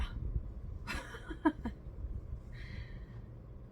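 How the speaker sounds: high, breathy giggle; airy, trailing laughter; laughing. Real, not acted. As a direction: giggle helplessly at your own phrase. Laughs after saying gander.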